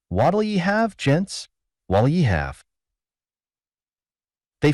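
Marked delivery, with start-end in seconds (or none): 0.1-2.6 high singsong opening, then dropping back; automatic patter. A narrator's reading. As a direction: rattle off a barkeeper's stock greeting. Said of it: "murmuring automatically".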